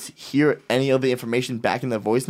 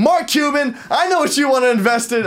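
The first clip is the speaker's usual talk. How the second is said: mock pitch; 12 semitones higher than usual. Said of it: "practice our Shark Tank pitch".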